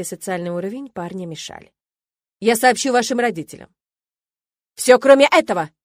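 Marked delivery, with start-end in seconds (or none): 2.4-3.7 curt. A narrator's reading. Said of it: "отрезал ректор". 4.7-5.7 loud, desperate bark; student protests to the rector about his parents. A narrator's reading. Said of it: "гаркнул Хант".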